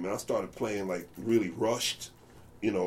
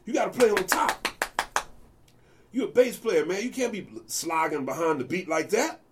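much higher, scolding; imitating the bandleaders' lecture; 9 semitones higher than usual. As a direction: lecture the kid about the beat. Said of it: "I said".

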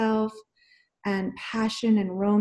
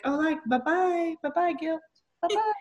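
higher, lilting "bye-bye, guilt"; singsong bye-bye; 7 semitones higher, 4 dB quieter than usual. Pretend. Waving goodbye to guilt as a bird flies past. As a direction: wave guilt off in a sweet sing-song. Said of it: "you're gonna be like".

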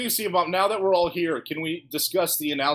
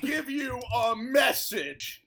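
slow dramatic voice; 7 semitones higher, slower than usual. Acted. Doing a voice, the message.

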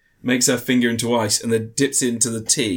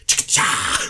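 blade pop noise; slower than usual. Retold or imitated. Imitates a blade popping out.

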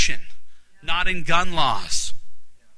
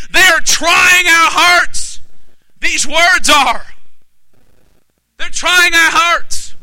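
high, loud, urgent preacher; full-force preaching; 9 semitones higher, 5 dB louder, slower than usual. Real, not acted. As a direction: drive it home, stretching the key words.

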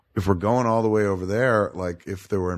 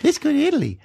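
imitating wife; 10 semitones higher, faster than usual. Wife quoted in Italy plan.

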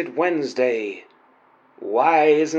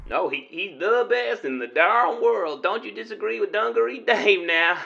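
put-on cowboy accent; 5 semitones higher, faster than usual. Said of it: "interesting accent you've got there".

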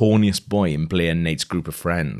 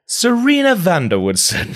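much higher and louder, a ringing hostess announcement; mother's sing-song call; 13 semitones higher, 5 dB louder than usual. Acted. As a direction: call the name down the hall like a delighted mother. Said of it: "I don't know why I said it like that".

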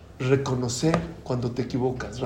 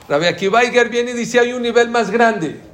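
emphatic preaching; 8 semitones higher, 6 dB louder than usual. Acted. Emphatic preaching of higher level. (proclamación enfática, alta y lenta)

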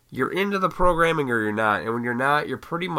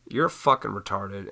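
ordinary delivery

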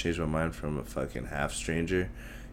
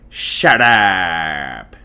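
"Shut up" said as "shaht ahp", loud and drawn out; loud, stretched Indian-movie "Shut up"; Tamil film accent; 12 dB louder than usual. Retold or imitated. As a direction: bark it like a Bollywood villain. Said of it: "in the movies ... they say".

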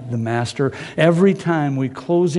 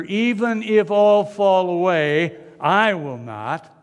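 higher, slow and sure; confident Peter; 6 semitones higher, slower than usual. Acted. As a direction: draw out the boast. Peter repeats, drawn out.

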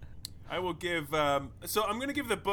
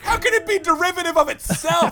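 incredulous; 13 semitones higher, 10 dB louder than usual. Real, not acted. Incredulous outburst.

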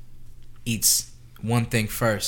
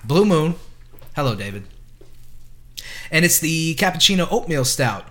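announcer voice; 5 semitones higher, 8 dB louder than usual. Acted. Host announces the beer.

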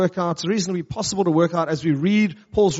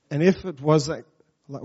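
ordinary talk

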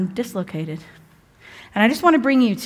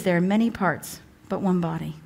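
normal talking voice

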